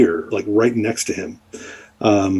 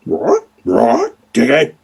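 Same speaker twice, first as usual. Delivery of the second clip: high squawking bird-creature croak; raucous high creature cry; 4 semitones higher, slower than usual. Acted. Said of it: "it croaks right in your face".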